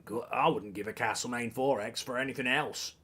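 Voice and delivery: low, badly faked Australian; terrible Australian accent. Shown as a demonstration. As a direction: have another poor stab at Australian, softly. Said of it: "It's terrible".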